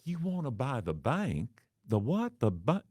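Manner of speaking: an overly friendly receptionist who is confused; Southern Miss Congeniality voice; sweet receptionist. Retold or imitated. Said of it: "Female, sweet, caring, and efficient, but overly friendly".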